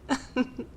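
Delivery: short, soft, high chuckle; amused chuckle. Acted. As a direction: chuckle lightly to yourself in disbelief. Amused at mentioning a soul.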